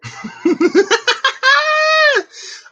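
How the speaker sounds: high squealing laugh; delighted laugh. Real, not acted. Amused by Siri saying apple, high laugh. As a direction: burst out in a high, loud laugh.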